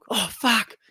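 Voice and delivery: high startled yelp, imitated; mock startled curse. Student sees thing again and bolts, high imitated yelp. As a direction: play the kid's sudden fright.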